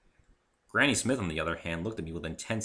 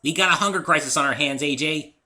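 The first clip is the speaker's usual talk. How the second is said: serious Pinkie; 8 semitones higher, 9 dB louder than usual. A narrator's reading. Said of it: "in a surprisingly serious tone".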